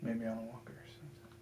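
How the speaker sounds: hushed whisper. Real, not acted. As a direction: whisper it under your breath. Ghost hunt hushed aside.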